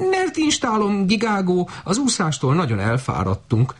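high, honking goose plea; high goose voice, weary; tired goose. A narrator's reading. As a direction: whine like a worn-out goose.

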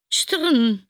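imitated rattle, high and loud; high, loud drainpipe jangle; metallic clatter. A narrator's reading. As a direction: make a sudden loud clinking noise. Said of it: "csörrent megint valami".

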